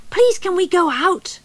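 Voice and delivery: small bear begging to go out; child's plea. A narrator's reading. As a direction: beg Mum like a little cub. Child voice asking mum.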